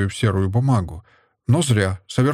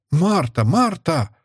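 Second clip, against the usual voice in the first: mother's higher-pitched repeated summons; mild cry for the maid; calling out; 7 semitones higher, slower than usual. A narrator's reading. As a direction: call out, pitch raised. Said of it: "снова закричала мать".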